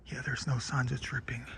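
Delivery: ordinary delivery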